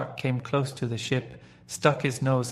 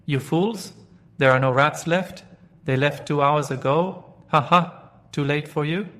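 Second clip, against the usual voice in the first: loud, higher jeering shout; barking taunt; 4 semitones higher, 5 dB louder than usual. Acted. Dog jeering at the sinking pirates, loud mocking shout. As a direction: jeer at them across the water. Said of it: "Jip barked from the doctor's ship".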